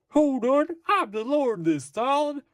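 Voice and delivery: high-pitched, hammed-up officer voice; stock TV lawman. Police officer standing up to the Phantom, high hammed-up voice. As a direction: squawk it high like a caricature cop. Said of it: "a character that speaks like this".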